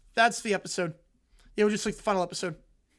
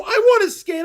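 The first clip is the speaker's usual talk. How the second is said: put-on whiny kid shout; 11 semitones higher, 11 dB louder than usual. Kid whining on family trip.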